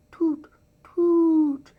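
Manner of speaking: high, loud little toots of fright; frightened toots. Acted. Scared tugboat answering the owl, high frightened toots. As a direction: toot out for help in the dark. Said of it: "cried the frightened tugboat".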